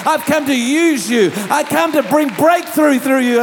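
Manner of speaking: loud, building declaration; driving, emphatic repeated phrases; impassioned preaching. Acted. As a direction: voice God's promise with mounting force. Preacher voices God's rising commission.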